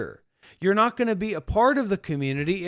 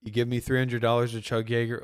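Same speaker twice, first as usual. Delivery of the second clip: ordinary talk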